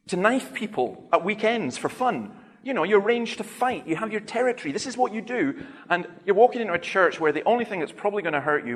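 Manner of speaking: half-laughing, pushing him to see sense; incredulous challenge. Retold or imitated. Preacher challenging a scared gang leader outside church, incredulous.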